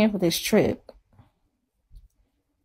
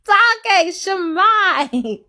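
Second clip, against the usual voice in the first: much higher, louder laughing imitation of her husband; mock husband; 13 semitones higher, 6 dB louder, much slower than usual. Wife mimicking husband telling her to stop, laughing. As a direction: squeal his words back, teasing. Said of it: "he like".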